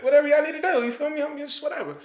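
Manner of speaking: very high-pitched retold shrug; acting out blithe agreement; carefree compliance. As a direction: pitch it way up, easygoing. Retells his carefree answer.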